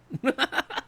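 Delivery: short, high burst of laughter; giving-up laugh. Real, not acted. Streamer failing to soften their T's, high laugh. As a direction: burst out laughing at the failed attempt.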